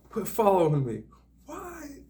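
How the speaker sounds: high mock yell leaping to a squeal; mimicked yell. Woman yells at man ahead to quit following, high mock yell. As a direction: imitate an agitated woman yelling, pitch climbing. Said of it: "And she yells it".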